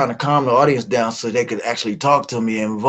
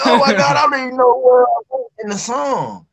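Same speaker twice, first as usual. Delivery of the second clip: panicked thought; 11 semitones higher, 4 dB louder than usual. Retold or imitated. Panicked inner thoughts on stage.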